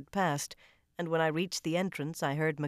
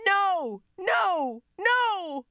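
loud, much higher screams; hysterical screaming; 7 dB louder, much slower than usual. A narrator's reading. Lucy screaming hysterically in the water, shrieked. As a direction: shriek each no, frantic. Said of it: "Lucy screamed".

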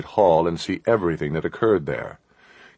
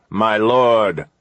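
restrained, drawn-out alarmed call; shouted; 3 semitones higher, 4 dB louder than usual. A narrator's reading. Sir Guy alerting his lord, a contained shout. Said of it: "he shouted".

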